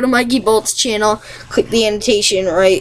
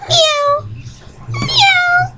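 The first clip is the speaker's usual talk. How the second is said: high squeaky meowing like a kitten; cat meow. Pretend. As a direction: mew it out high and thin. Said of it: "I'm just gonna make cat noises".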